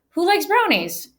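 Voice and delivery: lone rhetorical question. Shown as a demonstration. Teacher demos a dull rhetorical question, sing-song high. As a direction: toss out a lone question brightly. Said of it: "So if I just say".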